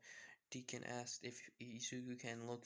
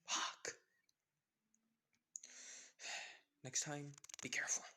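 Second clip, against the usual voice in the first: annoyed whisper; 3 semitones higher than usual. Acted. Izuku annoyed at Jacob.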